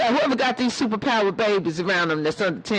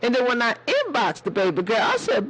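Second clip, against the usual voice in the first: ordinary delivery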